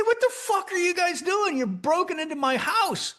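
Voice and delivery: angry yell. Retold or imitated. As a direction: shout them down, furious and high.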